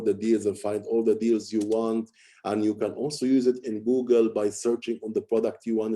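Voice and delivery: same tone of voice throughout, no emphasis; boring monotone. Shown as a demonstration. Coach demonstrating a dull presentation, boring monotone. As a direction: reel it off on one level note without variation. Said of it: "Okay, let me show you this".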